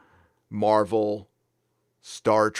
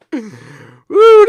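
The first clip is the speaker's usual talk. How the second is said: whoop; 14 semitones higher, 15 dB louder than usual. Real, not acted. Laughing at border closing joke.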